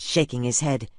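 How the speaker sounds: plain reading voice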